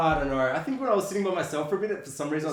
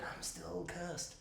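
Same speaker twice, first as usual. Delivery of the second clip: hushed, slow creature voice; cursed monkey; 15 dB quieter, much slower than usual. Retold or imitated. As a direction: draw it out very softly as the monkey. Voicing the monkey after the credits.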